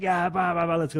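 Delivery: high, hyped-up chant; pumped-up hype. Retold or imitated. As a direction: pump yourself up before a match. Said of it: "really loud and really energetic".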